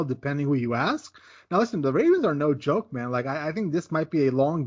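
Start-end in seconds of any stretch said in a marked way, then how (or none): none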